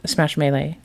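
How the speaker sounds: ordinary talk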